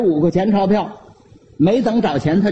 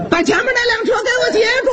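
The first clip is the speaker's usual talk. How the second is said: desperate yell; much faster than usual. Acted. The uncle yells to passers-by to stop the bike ahead, frantic.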